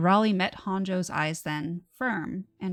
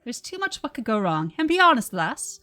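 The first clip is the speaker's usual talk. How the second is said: booming Irish-Scottish brogue, louder and higher; Irish-Scottish accent; 4 semitones higher, 5 dB louder than usual. Acted. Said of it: "now I have to do the accent".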